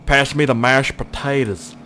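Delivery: slow, drawn-out put-on backwoods driver voice. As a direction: imitate the guy driving, stretching the words. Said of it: "Look at that fucking guy".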